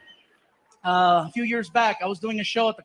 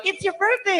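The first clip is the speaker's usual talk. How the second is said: high, sing-song club worker voice; playful high greeting; club staff greeting; 10 semitones higher than usual. Retold or imitated. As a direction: chirp it like a party host.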